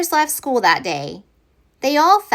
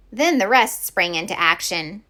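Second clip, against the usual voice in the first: ordinary delivery, nothing marked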